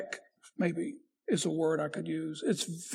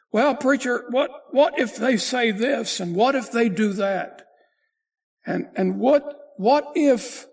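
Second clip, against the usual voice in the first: a higher, louder voice of a pleading objector; doubting church member; 4 semitones higher, 6 dB louder than usual. Pretend. Preacher voicing a skeptical congregant, higher and louder. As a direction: push back like a troubled listener who can't let go.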